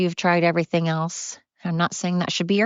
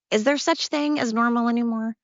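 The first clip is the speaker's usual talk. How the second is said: exasperated question; 6 semitones higher than usual. Real, not acted. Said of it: "repeats the question".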